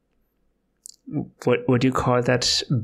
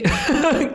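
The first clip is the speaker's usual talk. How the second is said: high laugh breaking character; burst of laughter. Real, not acted. Player laughing at own accent attempt. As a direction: cracks up mid-voice.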